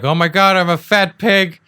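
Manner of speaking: high, loud mock wail; imitating a distraught partner. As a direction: wail it as an exaggerated self-pitying complaint. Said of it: "when your partner's like".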